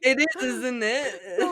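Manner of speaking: slow and loud, laughing through it; laughing reread. Real, not acted. Explaining her misread line to her friend, laughing. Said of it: "it says".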